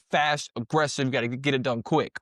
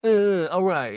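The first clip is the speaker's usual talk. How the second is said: a louder, higher half-hearted giving in; mock surrender; 4 semitones higher, 4 dB louder than usual. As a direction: play the tough guy suddenly backing down, a bit hammed-up. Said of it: "And then the guy's like".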